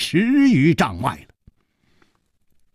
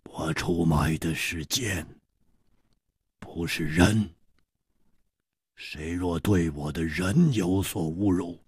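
low, quiet, icy interruption; chilly, hushed low voice; cold; 5 semitones lower, 7 dB quieter than usual. A narrator's reading. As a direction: cut in coldly, keeping it low and soft. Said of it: "一点红冷冷地打断了他们的话".

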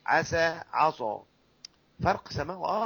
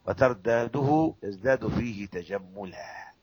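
poem recitation; 5 semitones lower, slower than usual. Shown as a demonstration.

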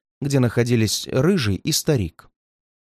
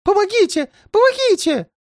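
put-on much higher, frantic old man's cry; terrified cry for help; 3 dB louder than usual. A narrator's reading. Old man cries for help, terrified and high. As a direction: scream for help in mortal terror. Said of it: "закричал старик в смертельном ужасе".

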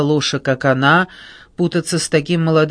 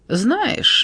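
breezy and higher-pitched; light, unbothered opener; carefree; 9 semitones higher than usual. A narrator's reading. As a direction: toss it off without a care. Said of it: "беззаботно сказала она".

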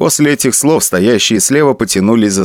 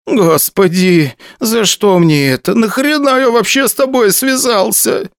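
whining; 11 semitones higher than usual. A narrator's reading. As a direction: whine it like a beaten puppy. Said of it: "заскулил".

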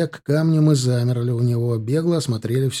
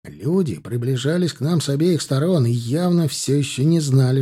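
ordinary delivery, nothing marked

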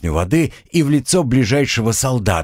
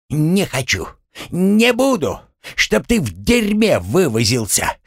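enraged refusal; 9 semitones higher than usual. A narrator's reading. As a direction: spit it back in rage. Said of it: "взъярился Гремлун". (взбешённый отказ)

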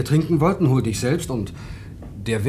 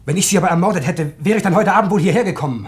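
heated defence; 6 semitones higher, 6 dB louder than usual. Acted. Defends himself against murder suspicion.

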